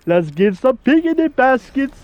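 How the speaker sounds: hammed-up Yogi impression; Yogi Bear. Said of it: "I can do the Yogi voice".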